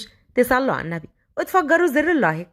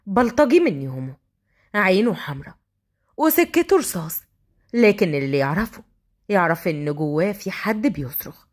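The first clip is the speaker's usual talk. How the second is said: ordinary delivery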